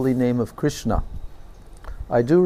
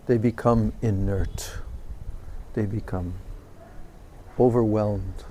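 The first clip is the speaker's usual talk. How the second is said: soft, sinking, lower voice as if overcome; hushed overwhelmed; 7 semitones lower, 9 dB quieter than usual. Retold or imitated. Retelling the saint's words to listeners, hushed and overcome. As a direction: let the words fade as the senses go still. Quote on senses overwhelmed by nectar.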